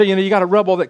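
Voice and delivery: ordinary talk, nothing marked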